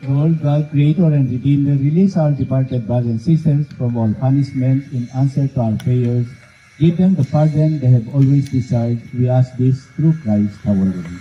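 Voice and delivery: loud, steady liturgical prayer. Acted. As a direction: pray it out over the grave. Priest leads closing prayer.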